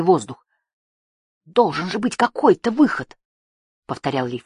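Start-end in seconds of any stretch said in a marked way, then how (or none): 1.4-3.2 high, emphatic exclamation; frustrated, raised-pitch plea; desperate insistence. A narrator's reading. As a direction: insist on it against all hope. Trapped hero insisting on escape.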